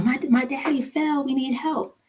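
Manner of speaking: retold child's urgent plea. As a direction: plead for help in a small, high voice. Imagined children calling for help.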